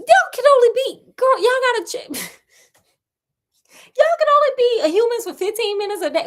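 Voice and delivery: aghast that brothers stay swans most of the day; incredulous outburst. Real, not acted. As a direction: shriek it in mock disbelief.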